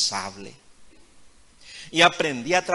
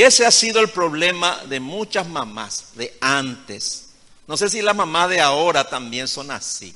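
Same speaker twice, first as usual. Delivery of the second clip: louder, emphatic sermon voice; preaching; 3 semitones higher, 4 dB louder than usual. Acted. Preacher warns the mothers in the congregation, preaching. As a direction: drive the lesson home to the flock.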